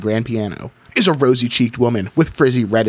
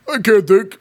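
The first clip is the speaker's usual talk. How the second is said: monster voice; 8 semitones higher, 3 dB louder than usual. Acted. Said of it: "I totally lost this monster voice".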